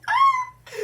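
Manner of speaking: short, very high, loud squeal; sudden high shriek of delight; excited squeal. Real, not acted. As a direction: let out a piercing fangirl squeal. Reacts thrilled to a film romance.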